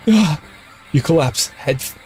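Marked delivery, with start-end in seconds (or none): 0.1-0.4 sharp high yelp of shock; sudden high yelp; startled yelp. Real, not acted. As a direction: jump at the scare. Scream reacting to game horror.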